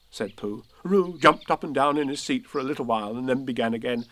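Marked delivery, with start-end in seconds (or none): none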